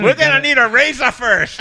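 put-on high, loud caricatured Chinese accent; hammed-up Chinese clinic man; mock Chinese accent. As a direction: exclaim in a fake Chinese accent. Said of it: "everybody can do a Chinese accent".

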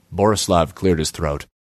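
plain reading voice

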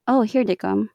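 ordinary talk, nothing marked

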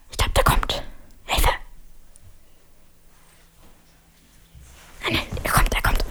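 urgent unvoiced whisper. Real, not acted. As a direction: whisper it like he might hear you. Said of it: "Flüstern".

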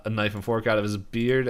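ordinary talk, nothing marked

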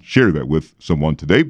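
usual speaking voice

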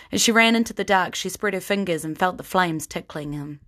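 ordinary delivery

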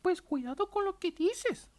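slow, high warning lady voice; angry lady voice. Retold or imitated. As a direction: threaten slowly like an offended older woman. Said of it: "y ella me dice".

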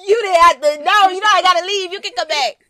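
high, loud, fast protest; loud, high-pitched banter; calling out. Real, not acted. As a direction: shout after someone who is leaving. Calls loudly to someone leaving.

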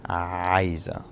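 "عايزة" with the throaty ain sound at the start; first letter demo. Shown as a demonstration. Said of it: "saying that first letter".

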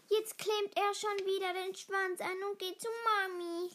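put-on high, jeering young wolf, no real laughter; mocking laugh. A narrator's reading. Wirbelwind taunts Schneeball about running to mummy, mocking. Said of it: "lachte Wirbelwind".

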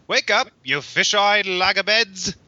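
high, called-out Australian voice; Australian accent. A narrator's reading. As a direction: holler it in a put-on Australian accent. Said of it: "He turned Australian for some reason".

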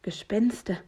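plain reading voice

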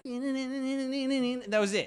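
slow, drawn-out awed exclamation, pitched high; mock awed kid. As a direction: savour it like the song just dropped. Voicing kids at Punjabi remix.

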